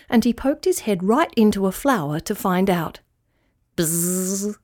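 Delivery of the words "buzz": soft buzzing with a rise at the end; bee buzz. A narrator's reading. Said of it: "said the bee".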